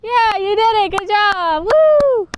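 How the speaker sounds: high, loud whooping encouragement; mock cheering. Handmaids cheering Janine on, a shrill mock cheer. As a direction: cheer her on, ending in a big woo. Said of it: "There's a bunch of handmaids there to be like".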